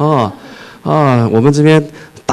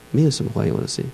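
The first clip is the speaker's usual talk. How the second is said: much softer, almost a breath of disappointment; hushed, deflated; 10 dB quieter, faster than usual. Retold or imitated. God describing silent welcomes elsewhere, softly deflated. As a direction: let the words fall away to nothing. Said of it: "他说他到别的地方去啊".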